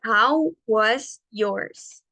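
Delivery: very slow, careful English model; slow model phrase. Shown as a demonstration. Teacher presenting a new phrase slowly. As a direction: draw each word out. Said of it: "teacher models phrase again".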